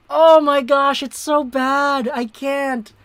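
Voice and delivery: high, loud exasperated groan; fed up with the hiccups; exasperated outcry. Real, not acted. Hiccup fit mid commentary.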